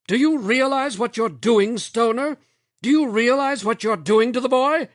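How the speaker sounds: high, loud accusing demand; angry shouting. A narrator's reading. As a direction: hammer the question home. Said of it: "Lomax shouted".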